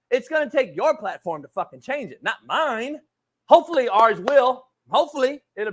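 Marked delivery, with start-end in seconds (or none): none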